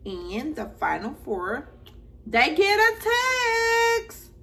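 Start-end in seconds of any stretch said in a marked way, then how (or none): 2.3-4.3 dramatic announcement. Pretend. Dramatic reveal of the text.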